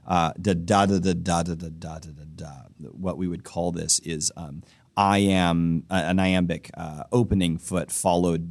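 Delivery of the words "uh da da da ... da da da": da da da chanted to beat out the meter; sing-song da syllables fading off at the end; rhythm in da syllables. Shown as a demonstration. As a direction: tap out the poem's rhythm on da. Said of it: "It's not, it's not just iambic".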